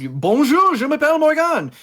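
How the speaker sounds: loud, high-pitched greeting in French; exuberant French greeting. Retold or imitated. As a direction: throw out a big, bold bonjour. Acts out greeting French players.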